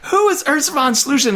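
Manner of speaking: high, exasperated mock question; acted bewildered cry. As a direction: cry out in baffled protest. Victim baffled in retold quarrel.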